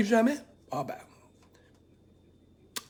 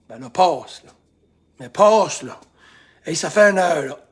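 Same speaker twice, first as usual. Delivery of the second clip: louder, impatient demand for it to pass; frustrated pushing; 10 dB louder than usual. Shown as a demonstration. Coach acting out forcing the bad mood, louder. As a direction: snap at the feeling to go away. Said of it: "Là, je vous le fais physiquement".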